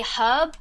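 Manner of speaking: "herb" with the H sounded at the start. Shown as a demonstration. Briton challenges American over herb, "herb" with a sounded H. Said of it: "it's got a H".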